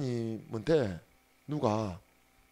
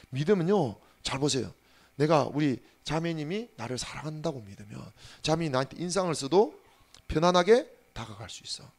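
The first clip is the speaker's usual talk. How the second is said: ordinary talk, nothing marked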